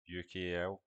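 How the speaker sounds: normal talking voice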